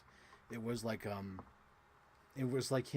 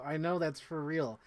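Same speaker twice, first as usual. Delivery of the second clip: ordinary delivery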